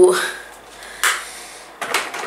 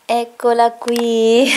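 excited; 5 semitones higher, much slower than usual. Real, not acted. Unveiling the gift to viewers.

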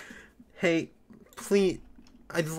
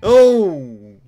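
startled; 6 semitones higher, 18 dB louder than usual. Real, not acted. Startled by a sudden alert sound.

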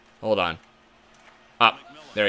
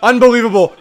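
much higher and louder, astonished; amazed cry; 8 semitones higher, 5 dB louder than usual. Real, not acted. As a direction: marvel loudly at the save. Reacting to a great save.

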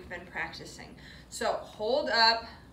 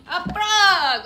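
higher, much louder reveal; excited reveal; 8 semitones higher, 13 dB louder than usual. Real, not acted. Teacher revealing the frog to kids, excited. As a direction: announce it with delight.

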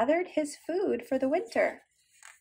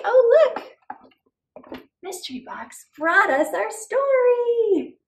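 much louder and higher, thrilled; excited reveal; 6 semitones higher, 6 dB louder, slower than usual. Acted. Teacher showing children the mystery box, excited and loud. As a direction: build wonder as the box opens.

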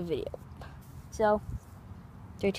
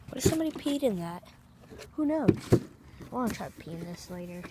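ordinary delivery

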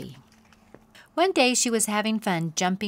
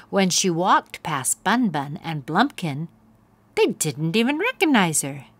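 plain reading voice

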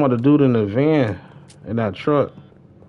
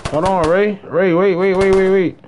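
higher and louder, urgently calling wait; calling out to stop; 7 semitones higher, 3 dB louder than usual. Real, not acted. Streamer calling Ray back in the game, urgent higher call. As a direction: hurry to stop him before he goes.

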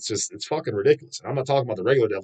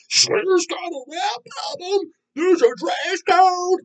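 much higher, slow squeaky mock voice; mocking clueless commenters; much slower than usual. Vlogger parodying clueless metal commenters, high slow squeaky mockery. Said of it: "all this stupid shit people are saying".

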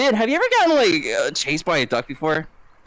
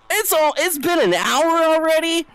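much higher, incredulous question; surprised disbelief; 11 semitones higher than usual. Real, not acted. Streamer finding an hour has already passed, high-pitched disbelief. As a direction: yelp it in disbelief.

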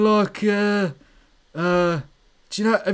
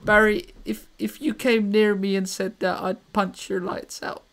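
Shimmy; 4 dB quieter than usual. Pretend. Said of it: "Shimizu, Shimmy".

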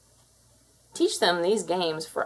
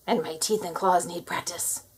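sly menace. Acted. The cat's pose speaking to the human, sly and menacing. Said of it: "This pose says".

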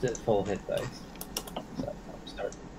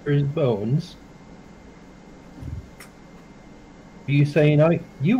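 surprised; 6 semitones higher, 6 dB louder than usual. Real, not acted. Player startled by crone's offer.

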